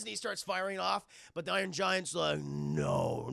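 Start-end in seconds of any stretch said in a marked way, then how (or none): 2.4-3.3 very low, booming robot voice; giant robot. Retold or imitated. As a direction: refuse like a lumbering metal giant. Giant robot refusing, retold.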